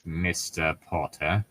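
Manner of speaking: low, soft, slow "Mister Potter"; mock stern Snape. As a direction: drawl it like a disdainful professor.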